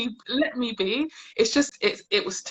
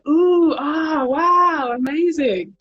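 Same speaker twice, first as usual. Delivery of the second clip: higher, louder fake amazement; mock gushing; 7 semitones higher, 5 dB louder, much slower than usual. Colleagues fawning over her ponytail, mock gushing. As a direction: overdo the coworkers' wonder.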